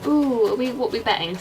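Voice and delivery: ordinary talk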